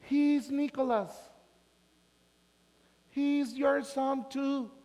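put-on high, very slow, accented English; slow gringa voice. Gringa slowly tells the ex about the son, high and slow. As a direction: drawl it out like a foreign mother.

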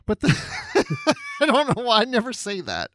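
laughing at herself mid-sentence. Real, not acted. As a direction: giggle through the words. Laughs at own slip.

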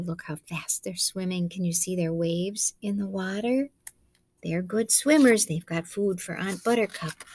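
plain reading voice

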